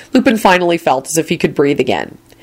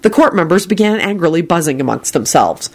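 plain reading voice

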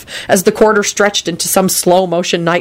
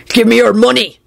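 higher-pitched robber's demand; threatening yell; 5 semitones higher, 4 dB louder than usual. A narrator's reading. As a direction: yell it as a threat, not full out. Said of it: "the man yelled threateningly".

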